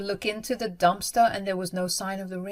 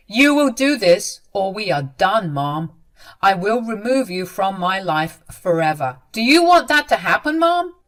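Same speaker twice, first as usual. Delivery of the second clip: stern ultimatum; 7 dB louder than usual. A narrator's reading. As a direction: threaten her, pressing harder at the end. Son threatens to cut off mother.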